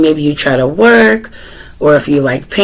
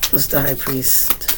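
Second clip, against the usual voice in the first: quiet; 11 dB quieter than usual. Real, not acted. Quietly asking the cards again.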